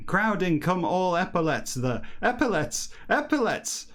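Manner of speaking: lightly imitating dad after a few drinks; my dad tipsy. Son mocking how his dad talks when drunk, lightly tipsy voice. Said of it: "Definitely my dad".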